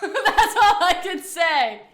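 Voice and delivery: high, loud laughing admission. Real, not acted. After own attempt at English accent, real laughing. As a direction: burst out laughing through the words.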